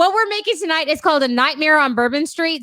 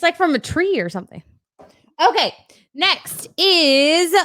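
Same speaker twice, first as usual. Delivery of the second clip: ordinary delivery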